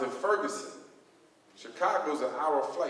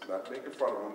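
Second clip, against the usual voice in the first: ordinary talk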